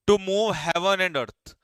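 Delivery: idiom read out very slowly and a bit loud; teacher announcing the idiom slowly; slow dictation. Shown as a demonstration. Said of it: "जब आप किसी चीज को पाने के लिए".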